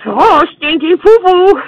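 Adam Sandler impression. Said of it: "Adam Sandler impression".

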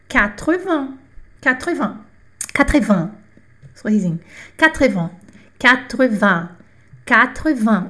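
Quatre-vingts said as kat-ruh-VAN, nasal end; French quatre-vingts. Shown as a demonstration. Teacher drilling Quatre-vingts for students to repeat. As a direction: model Quatre-vingts crisply for repetition.

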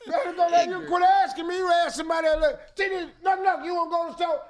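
high-pitched hollered chatter; hammed-up squabbling voice, pitched high. Acting loud crowded-house argument.

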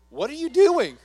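loud, high mock accusing shout; hammed-up yelling Satan. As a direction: scold like a furious accuser. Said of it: "He does this to me every day".

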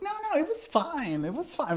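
squeaky high denial, mocking; imitating a flustered boyfriend, very high; high mock Glenn voice. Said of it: "he's like".

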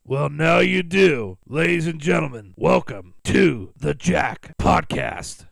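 loud, slow show intro; announcer voice. Acted. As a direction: welcome them like a host.

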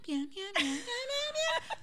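high, rising whiny meows; imitating cat meows. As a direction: mimic a cat crying for food. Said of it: "this bitch is like".

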